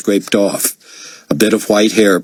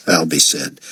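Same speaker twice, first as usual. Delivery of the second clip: ordinary delivery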